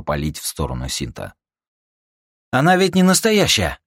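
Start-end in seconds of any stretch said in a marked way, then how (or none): 2.5-3.8 high, loud protest; shouting. Acted. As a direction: yell over gunfire. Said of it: "крикнул Арти".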